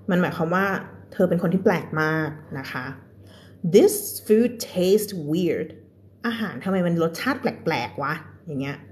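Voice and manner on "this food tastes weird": very slow, careful read; slow example sentence. Shown as a demonstration. Teacher reads an example sentence to students, very slowly. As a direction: drag each word out clearly.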